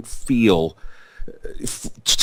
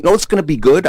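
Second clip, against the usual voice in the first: put-on Brooklyn accent; 7 semitones higher, much faster than usual. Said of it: "I said in my thick Brooklyn accent".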